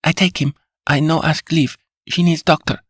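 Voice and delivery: a lightly voiced Spanish maid, protesting; indignant Spanish accent. A narrator's reading. Maid insists on doctor.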